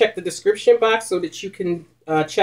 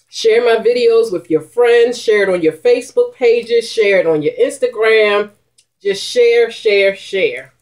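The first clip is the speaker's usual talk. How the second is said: emphatic urging; 6 semitones higher, 3 dB louder than usual. Real, not acted. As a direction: press viewers to share. Urging viewers to share.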